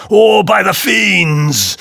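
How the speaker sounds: loud, high, slow command; shout. A narrator's reading. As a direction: bellow the order. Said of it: "shouted the monster".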